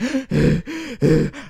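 a big gasp for air after running; breathless gasp. Retold or imitated. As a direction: gasp like you just ran to the gate. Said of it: "I'm, like, out of breath".

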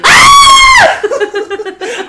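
very high, loud laughing shriek; ear-splitting shriek of laughter. Real, not acted. Host cracking up at a co-host's dig, piercing laughing shriek. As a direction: shriek with laughter till it hurts the ears. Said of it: "everyone's ears who I just destroyed".

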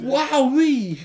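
high loud wowee; hammed-up awestruck cry; mock amazement. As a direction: squeal it like a cartoon.

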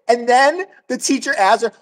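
ordinary talk, nothing marked

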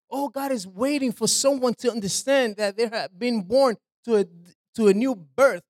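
usual speaking voice

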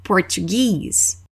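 "Português" said as English "Portuguese", long stressed "-GUESE"; slow "Português" as por-chu-GEEZ, weight on the end; English final stress. Shown as a demonstration. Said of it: "nós damos ênfase no final".

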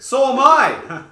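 loud, slow, drawn-out shout. Real, not acted. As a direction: yell it back at him.

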